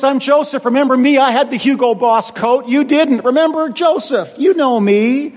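boastful Joseph. Pretend. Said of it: "Or how about this?".

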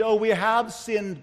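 ordinary talk, nothing marked

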